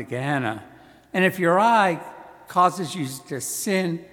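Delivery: measured, solemn scripture reading; Gospel proclamation. Acted.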